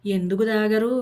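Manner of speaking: ordinary delivery, nothing marked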